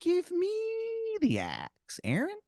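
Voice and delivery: squeaky tooth making an offer; tempting tooth creature. Acted. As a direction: tempt him like a tiny mob boss. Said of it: "And he goes".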